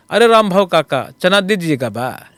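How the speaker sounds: put-on Bhojpuri accent. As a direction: call out to a street vendor in a Bhojpuri accent. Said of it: "so I do a bit of Bhojpuri also".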